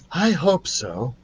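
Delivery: uncertain. Acted. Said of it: "sounded less certain".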